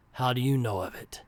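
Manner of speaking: ordinary delivery, nothing marked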